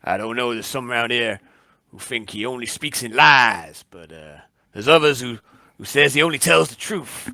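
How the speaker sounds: a lightly voiced barkeep answering a stranger; barman. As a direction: chat like a helpful bar owner. GM plays the barman.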